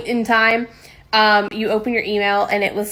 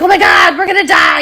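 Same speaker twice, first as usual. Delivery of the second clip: put-on high, loud panicked child shriek; childish panic; 8 semitones higher, 8 dB louder than usual. Child begging grandma about curse email, panicked shriek. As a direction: squeal it like a terrified kid.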